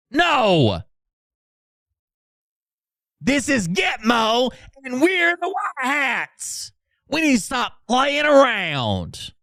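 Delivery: high, slow shouted tough-guy bark; mock drill sergeant. Mocking the chat, shouting as a hammed-up Gitmo guard. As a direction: yell it like a cartoon hardass commander.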